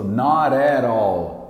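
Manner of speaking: slow American "not at all", nah-de-rall; slow-motion American linking of "not at all"; American accent. Shown as a demonstration. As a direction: draw out American flapped t's in "not at all". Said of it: "Esse T vai ser R".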